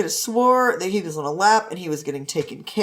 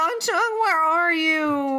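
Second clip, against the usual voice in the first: slow whiny call; 7 semitones higher, much slower than usual. Acted.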